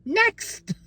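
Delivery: high, flippant brush-off; dismissive. Retold or imitated. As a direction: skip him with a high dismissive toss. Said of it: "I was like".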